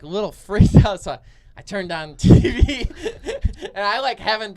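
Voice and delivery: real laughter bursting into the words; laughing while talking. Real, not acted. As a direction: laugh through an embarrassed admission. Laughs admitting he was scared.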